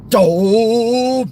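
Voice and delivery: long, high, drawn-out annoyed grunt; James Finlayson. Retold or imitated. Imitating a comedy foil to Laurel and Hardy, long exasperated grunt. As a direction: stretch it out, rising in exasperation. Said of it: "there was a Scottish actor".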